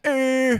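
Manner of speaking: "Eh" as a loud, high British er; British hesitation sound. Shown as a demonstration. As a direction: say "Eh" like a Brit searching for a word. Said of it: "But a British person goes".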